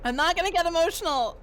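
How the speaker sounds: fighting not to cry; getting emotional. Real, not acted. Streamer moved by the companions' farewell, voice high and choked. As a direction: pitch jumps high, voice tight with held-back tears. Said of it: "I don't know why I'm getting emotional".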